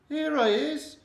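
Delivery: put-on high boyish here I is; high calling out. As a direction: call out from nowhere, cheeky. Invisible boy calls out to mother.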